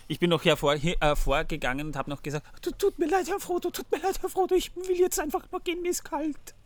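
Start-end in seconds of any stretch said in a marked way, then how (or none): 2.6-6.5 much higher, whimpering hobbit voice; Sam, shivering. Retold or imitated. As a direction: play a cold, pleading Sam. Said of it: "eine Anspielung an den".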